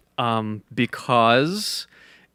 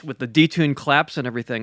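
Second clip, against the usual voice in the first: ordinary delivery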